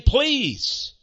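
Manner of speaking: high pleading please; imploring. Acted. Preacher voicing God's plea, high imploring. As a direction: beg with a rising please. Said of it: "He's imploring".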